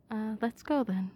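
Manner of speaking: normal talking voice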